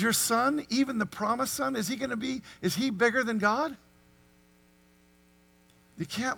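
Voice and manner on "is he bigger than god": ordinary talk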